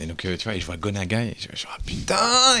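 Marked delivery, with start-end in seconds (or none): 1.8-2.6 very high, loud disbelieving cry; stunned excitement. Retold or imitated. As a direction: gasp it out, starstruck. Realising who the visitor is.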